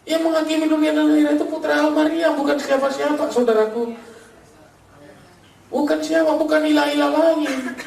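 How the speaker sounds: high-pitched, insistent preaching; preaching proclamation. Real, not acted. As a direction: drive it home to the congregation. Preacher drives the point home.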